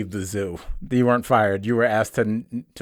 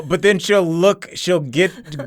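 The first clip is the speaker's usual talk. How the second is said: usual speaking voice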